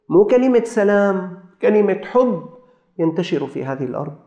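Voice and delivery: high, marvelling appeal to listeners; stirred preacher addressing the congregation; impassioned preaching. Real, not acted. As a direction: preach with fervour, lifting key phrases.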